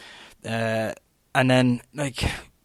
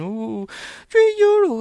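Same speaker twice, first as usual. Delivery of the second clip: high, loud whiny mock complaint; mocking whine; 7 dB louder, slower than usual. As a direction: squeal it like a spoiled moaner. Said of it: "Everyone else is crying".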